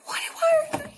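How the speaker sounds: very high squeaky whine; mock crying. Worker asking why they yell, in a high mock cry. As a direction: wail it like a tearful plea. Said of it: "I'm, like, crying in the bathroom".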